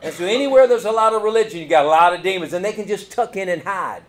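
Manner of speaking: full-force sermon voice; preaching. Real, not acted. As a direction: drive it home to the congregation.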